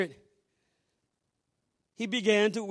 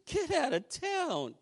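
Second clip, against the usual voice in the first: higher and slow, in disbelief; can't believe it, drawn out; astonished; 6 semitones higher, 5 dB quieter, slower than usual. Retold or imitated. Astonished reaction to her job.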